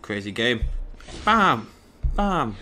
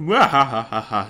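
loud mock villain cackle; evil laugh; 7 dB louder than usual. Gamer playing a posh character as slightly evil, loud villain laugh. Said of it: "Don't know why he's also evil".

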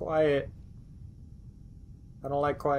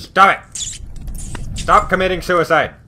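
frantic yelling; 6 semitones higher, 4 dB louder than usual. Real, not acted. Pleading with suicidal game character.

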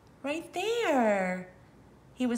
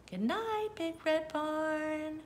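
high, soft and slow goodnight; 7 semitones higher, 6 dB quieter than usual. Acted. Ending the bedtime story, a soft goodnight. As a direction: tuck the barn in gently.

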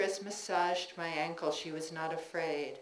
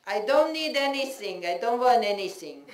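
put-on high, loud stubborn old mother; mother refusing; 6 semitones higher, 8 dB louder than usual. Mother refusing her child's help, high and loud. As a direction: push help away like a proud mother.

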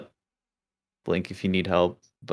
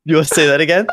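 mock challenge; 7 semitones higher, 7 dB louder, faster than usual. Teasing challenge.

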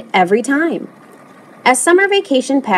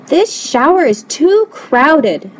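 slow, higher-pitched grumble; complaining; 6 semitones higher, slower than usual. A narrator's reading. Lewis's father objects to the crowded shower, complaining. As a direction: complain with drawn-out, lifted words. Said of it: "complained Lewis's father".

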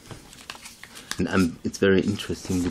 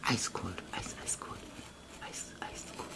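cold, hushed whisper fading even softer; icy whisper; 12 dB quieter than usual. Real, not acted. Vlogger to fans, whispering he turns ice cold. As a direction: breathe it like a chill threat.

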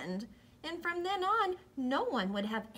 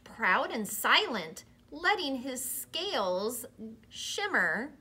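plain reading voice

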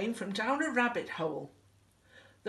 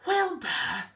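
high gasped name; startled high exclamation; astonished gasp; 10 semitones higher, 4 dB louder than usual. A narrator's reading. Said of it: "gasped Elmer".

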